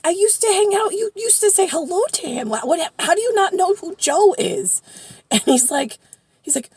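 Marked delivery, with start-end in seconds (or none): 0.0-4.8 high, loud disbelief; can't believe you don't know; incredulous. Retold or imitated. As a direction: argue it, voice pitched way up. Retold disbelief at friend's ignorance.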